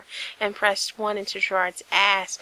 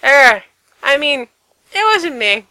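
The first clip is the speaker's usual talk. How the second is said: flustered; 6 semitones higher, 13 dB louder than usual. Acted. Frank backpedals, flustered.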